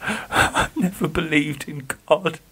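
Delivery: Geoffrey confessing, voice trembling. Acted. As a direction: admit it with a shaking voice. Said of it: "Geoffrey's voice trembled".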